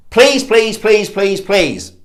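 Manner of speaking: high begging that gradually sinks; mock plea, word after word; upward pleading tone. Shown as a demonstration. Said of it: "the upward voice tone".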